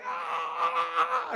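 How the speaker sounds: laughing high shout; high battle yell. Acted. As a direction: yell with the crowd, half laughing. Grandkids yelling at Jericho.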